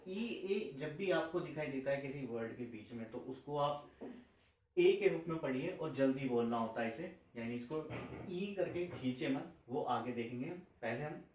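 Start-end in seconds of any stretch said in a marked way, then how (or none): none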